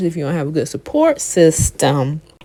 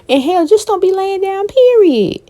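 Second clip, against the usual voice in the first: much higher, punchy warning; emphatic warning; 13 semitones higher than usual. Acted. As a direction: drive the point home, pitched way up. Emphatic advice to viewers.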